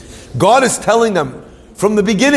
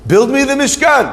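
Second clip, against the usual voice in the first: voice of God; 8 semitones higher than usual. Retold or imitated. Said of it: "God says to them".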